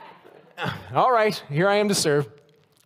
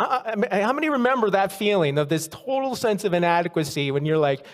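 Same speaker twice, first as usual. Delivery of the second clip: ordinary delivery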